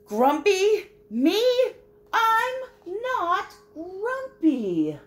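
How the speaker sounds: very high, loud, slow protest; indignant denial. Acted. Jim denies being grumpy to Snake. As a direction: howl the denial in outrage.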